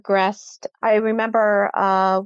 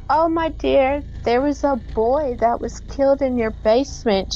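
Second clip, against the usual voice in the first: put-on old woman voice; 5 semitones higher than usual.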